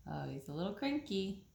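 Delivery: plain reading voice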